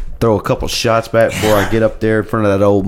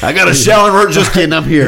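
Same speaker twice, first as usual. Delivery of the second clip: mock Chase arriving; 6 semitones higher, 5 dB louder, faster than usual. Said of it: "I was about to say".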